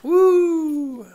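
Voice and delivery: high, loud celebratory whoop; cheering woo. Real, not acted. Cheering for her almost-birthday, a loud high whoop.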